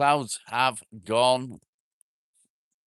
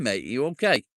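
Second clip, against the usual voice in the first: ordinary delivery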